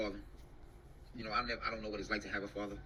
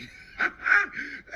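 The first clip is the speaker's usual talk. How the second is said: shrieking laugh; 10 dB louder than usual. Real, not acted.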